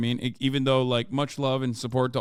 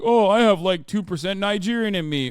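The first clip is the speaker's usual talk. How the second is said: hammed-up, louder and higher, to mock the boast; 5 semitones higher, 4 dB louder than usual.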